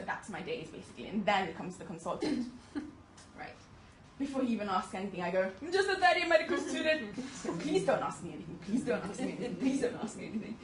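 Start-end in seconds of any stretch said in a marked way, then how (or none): 5.6-10.6 louder, higher begging to be left alone; hammed-up nervous plea, repeated; mock pleading. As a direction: plead with the consultant not to ask. Student pleading with consultant.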